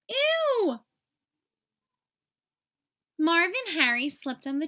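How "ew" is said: a high, squeaky "Ew!" of disgust; high disgusted cry. Acted. Burglar hitting the tar cries out high in disgust. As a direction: squeal it like you landed in tar.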